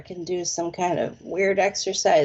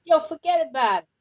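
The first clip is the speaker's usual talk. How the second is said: quick, higher-pitched Brooklyn demo; Brooklyn accent; 7 semitones higher, faster than usual. Shown as a demonstration. Correcting Richard's Texan-sounding attempt, Brooklyn accent. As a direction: show how Brooklyn really says it, fast. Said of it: "You said it like a Texan".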